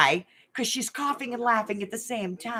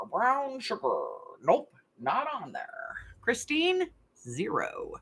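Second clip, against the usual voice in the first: game show host. Acted. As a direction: play the TV host reading out the scores. Hosts a Family Feud style game.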